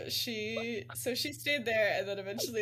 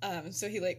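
ordinary talk

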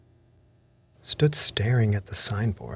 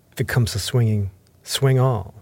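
forceful, somewhat louder outburst; crying out; 2 semitones higher, 5 dB louder than usual. A narrator's reading. Captain quarrelling with his visitor, raised-voice outburst. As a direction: ring it out defiantly, louder on the close. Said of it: "he cried once".

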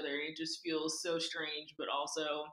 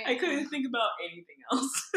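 laughing; 7 semitones higher, 5 dB louder than usual. Real, not acted. Laughing at own confession.

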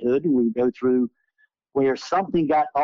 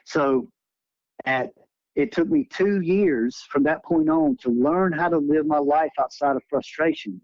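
ordinary talk

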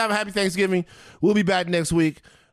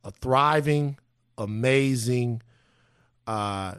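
solemn sincere; 6 semitones lower than usual. Real, not acted. Solemn Thanksgiving remembrance message.

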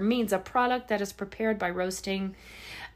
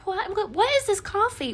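higher, sweet stammering what-what-what; polite version; 10 semitones higher than usual. Retold or imitated. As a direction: soften it for a sweet waitress, pitched up. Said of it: "'cause she was real sweet".

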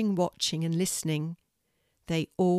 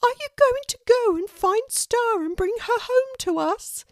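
high, louder, pleading little pelican voice; hopeful pelican Moon; 6 dB louder than usual. Acted. Moon begs Kelty to bring Star home, high and hopeful. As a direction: lift it up with sudden hope. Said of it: "she asked, hopefully".

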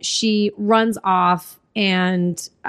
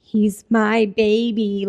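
slow, drawn-out possessive wail, imitated; mock-frantic mother claiming her child; freaking out; much slower than usual. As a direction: stretch each word in a put-on voice. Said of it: "like, freaking out about it".

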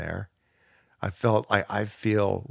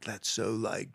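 softer mock-awed stoner murmur; a hint of a dazed surfer gazing at the sky; fake profundity; 7 dB quieter, slower than usual. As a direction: play it as hollow awe at a sunset. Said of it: "false sense of profundity".

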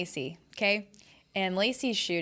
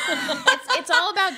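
laughing; 10 semitones higher, 7 dB louder, slower than usual. Real, not acted. Laughing nasal attempt.

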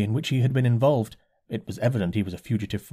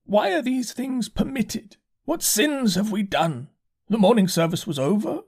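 complaining curate; 9 semitones higher than usual. A narrator's reading. Shaken curate complains to the narrator, high and whining. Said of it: "spoke in almost a complaining tone".

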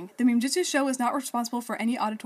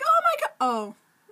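squealing, much higher; much faster than usual. Retold or imitated. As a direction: shriek it like a shocked fan. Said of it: "all the girls were like".

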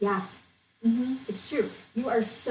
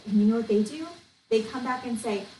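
ordinary talk

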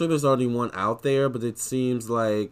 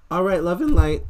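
voice cracking up an octave; 8 semitones higher, 9 dB louder than usual. Real, not acted. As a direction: voice cracks, jumping much higher and louder. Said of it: "sorry my voice just cracked".

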